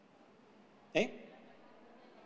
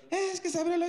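alarmed wife; 3 dB louder than usual. Retold or imitated. Retells wife's alarmed words.

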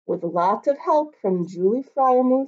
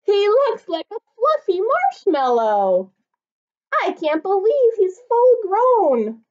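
put-on much higher, eager child voices; excited kids; 12 semitones higher, 7 dB louder than usual. Kids in the park spotting Norbert, much higher and excited. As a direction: squeal like amazed little kids. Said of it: "Kids yelled".

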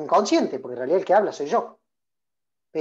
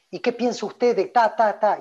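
interviewer; 4 semitones higher, 4 dB louder than usual. Retold or imitated. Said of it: "le hacen una pregunta complicada".

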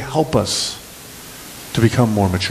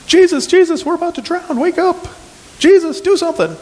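high, frantic plea for help; panicked cry. Acted. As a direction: cry out in panic as the boat sinks. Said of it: "And so they're panicking".

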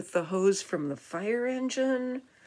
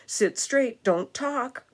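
raised, louder classroom command; storybook teacher telling pupils off; strict teacher; 3 semitones higher, 7 dB louder than usual. A narrator's reading. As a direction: order the class about. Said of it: "this is the teacher holding her chalk, telling the class".

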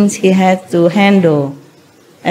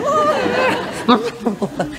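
high mock drowning splutter; drowning noise; 6 dB quieter than usual. Disciple held underwater by the master, mock gurgling cry. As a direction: imitate someone struggling underwater.

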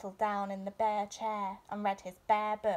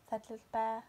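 ordinary delivery

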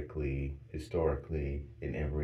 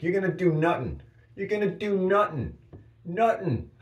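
much higher, loud taunting fighter voice; Conor McGregor; 8 dB louder than usual. Retold or imitated. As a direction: taunt like a cocky fighter, shouting high. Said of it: "I'd be like Conor McGregor".

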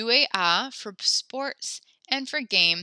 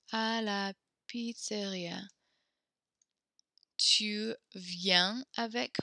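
slow, careful French model reading; slow model sentence; 4 dB quieter, slower than usual. Shown as a demonstration. Teacher slowly modelling the French sentence for students. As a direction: spell each word out slowly for the class. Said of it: "if my place is à la pizzeria, I would say".

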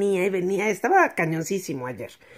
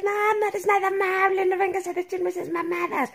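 higher mock angry neighbor; imitated neighbor shouting, higher pitch; imitating yelling; 11 semitones higher, 3 dB quieter than usual. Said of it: "le empezó a gritonear".

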